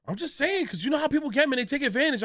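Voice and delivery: high, whining excuse to mom; whiny pleading. Retold or imitated. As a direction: plead your case in a high whine. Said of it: "I'm like".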